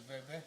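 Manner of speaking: "veve" for "verde", no R at all; can't roll R. Retold or imitated. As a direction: imitate someone who swaps the rd in "verde" for a v. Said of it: "they can't roll the R at all".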